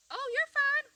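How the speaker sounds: cooing to chickens. Real, not acted. Soothing chickens that squabble.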